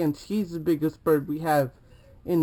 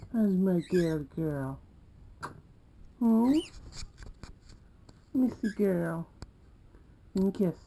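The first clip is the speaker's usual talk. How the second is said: soft cooing; 2 semitones higher, 7 dB quieter than usual. Real, not acted. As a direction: coo softly to a pet.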